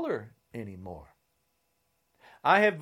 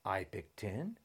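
whispered, softer threat; menacing whisper; 8 semitones lower, 16 dB quieter than usual. Real, not acted. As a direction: hiss the threat low. Challenge to a fight.

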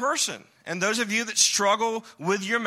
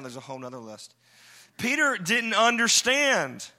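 ordinary talk

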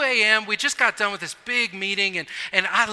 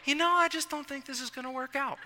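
soft, higher, gentle brush-off; polite letdown; 6 semitones higher, 7 dB quieter than usual. Retold or imitated. Turning down a bad first date, gently and softly. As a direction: let them down easy, quietly.

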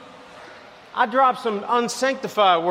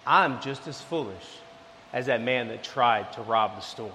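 much lower and softer, sober; humbled admission; 10 semitones lower, 7 dB quieter than usual. Acted. Preacher admitting his own foolishness, low and quiet. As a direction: say it quietly like a hard truth.

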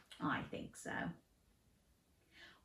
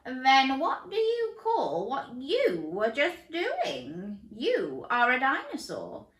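higher, slow, friendly coach voice; coach chameleon voice; 9 semitones higher, 4 dB louder, slower than usual. Acted. Coach introduces himself to Dan in a slow voice. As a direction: play the enthusiastic coach.

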